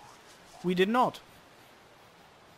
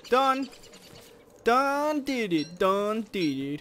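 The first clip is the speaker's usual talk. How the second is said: loud, high, slow done did it; triumphant; 4 semitones higher, 5 dB louder, slower than usual. Real, not acted. Gamer gloating after killing the spiders. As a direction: crow over the win.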